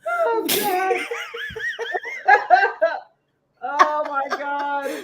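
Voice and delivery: high, slow, laughing groan. Real, not acted. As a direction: drag it out through laughter. Laughing fit continues.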